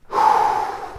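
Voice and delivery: loud, full breath in and out; big audible breath. Shown as a demonstration. Showing the viewer how the flushing breath sounds, loud and full. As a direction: demonstrate a deep flushing breath. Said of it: "sounds something like this".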